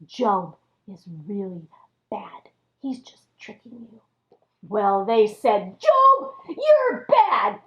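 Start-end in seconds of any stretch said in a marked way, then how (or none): 0.0-4.0 sly devil's whisper; very soft, low insinuating whisper. Acted. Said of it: "the devil whispered in their ears". 5.8-7.6 high, scolding accusation; acted mean finger-pointing. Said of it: "they were all being mean".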